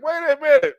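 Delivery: high, loud plea; mock panic. Guy begging her to wait, high panicked. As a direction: cry out to stop her.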